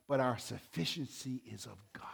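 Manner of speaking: whispered scripture close; hushed reading of Corinthians. Real, not acted. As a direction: breathe the verse out unvoiced. Scripture read softly.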